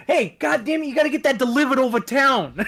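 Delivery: high, loud pizzeria boss order; pizza boss. Acted. Boss to pizza worker about a delivery, bossy. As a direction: bark it like a pizza shop boss. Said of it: "Like, the boss is like".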